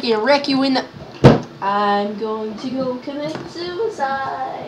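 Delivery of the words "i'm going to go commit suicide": slow, soft, dejected drone; hammed-up defeat; mock despair. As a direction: drag it out quietly in fake gloom. Mock despair after losing.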